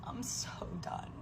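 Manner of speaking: exhausted unvoiced whisper. Real, not acted. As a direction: breathe it out, spent. Exhausted at the end of rant.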